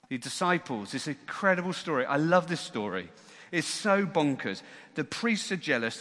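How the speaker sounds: usual speaking voice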